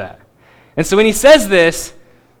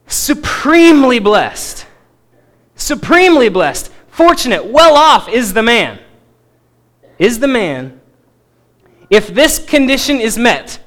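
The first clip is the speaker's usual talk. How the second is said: high, loud, weighty declaration; emphatic; 3 semitones higher, 5 dB louder than usual. Acted. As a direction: drive each phrase home, stretched out and forceful. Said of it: "It's emphatic".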